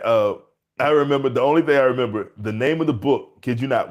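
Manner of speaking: ordinary delivery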